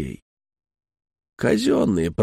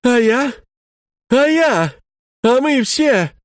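much higher, louder cry; wife bursting out at husband, high and loud; wife's outburst; 11 semitones higher, 4 dB louder, slower than usual. Retold or imitated. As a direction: cry out, hurt and indignant. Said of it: "Она вдруг вскочила".

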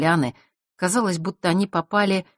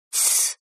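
quiet hushing sound; soft shushing hiss; 5 dB quieter than usual. A narrator's reading. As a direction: shush them softly. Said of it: "приложила палец к губам".

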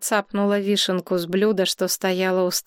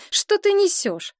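much higher-pitched exasperated question; irritated outburst; 13 semitones higher than usual. A narrator's reading. Woman fed up with drunk friend's nonsense, high irritated question. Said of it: "раздражённо выпалила Галина".

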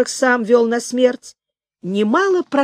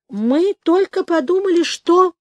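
high-pitched excuse, left hanging; nervous soldier; 8 semitones higher, 3 dB louder than usual. Acted. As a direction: plead an excuse in a much higher voice. Cowed soldier makes excuses to general. (оправдывающийся солдат, высоким голосом)

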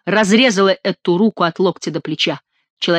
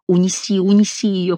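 ordinary delivery

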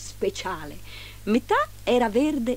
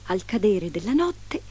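ordinary delivery, nothing marked

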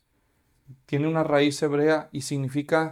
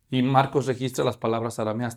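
ordinary talk

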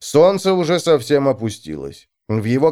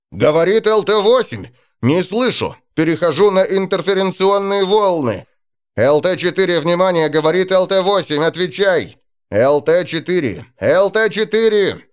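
higher, louder urgent radio calls; pilot over the radio; 6 semitones higher, 4 dB louder than usual. Acted. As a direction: call the lost pilot urgently. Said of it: "слышался голос пилота".

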